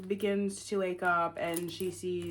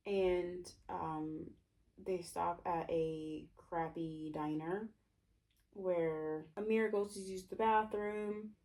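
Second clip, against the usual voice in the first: ordinary talk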